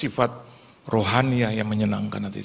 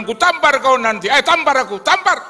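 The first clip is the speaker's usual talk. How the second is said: much higher, louder taunt; daring shout; 13 semitones higher, 7 dB louder than usual. Retold or imitated. Big man dares him, loud high taunt. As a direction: dare him to slap. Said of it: "Dia bilang".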